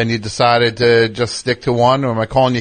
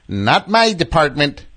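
dismissive clerk; 4 semitones higher, slower than usual. Retold or imitated. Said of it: "The guy said".

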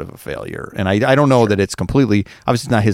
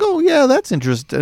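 pleasantly intrigued. Retold or imitated. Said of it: "I would have been like".

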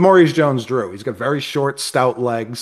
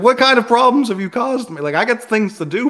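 higher-pitched mock telling-off; put-upon owner pleading, pitched up high; exasperated scolding; 10 semitones higher than usual. Owner retelling how he questioned his troublesome dog, exasperated.

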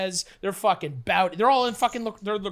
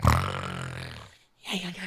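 deep drugged groan; 3 dB quieter, much slower than usual. Retold or imitated. Drug hitting him.

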